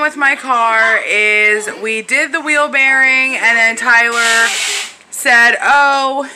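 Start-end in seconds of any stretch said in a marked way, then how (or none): none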